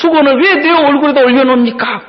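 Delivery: high-pitched indignant customer; acted-out annoyed demand; indignant complaint. Customer complaining to barber in story.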